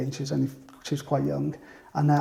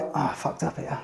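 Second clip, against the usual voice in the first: sheepish aside; 7 dB quieter than usual. Retold or imitated. Customer privately realises his mistake.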